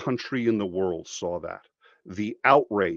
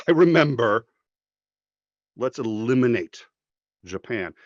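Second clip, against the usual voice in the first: mock vengeful voice; 7 dB louder than usual. Mocking people who wanted revenge on Japan, hammed-up and loud. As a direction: ham up a vengeful voice.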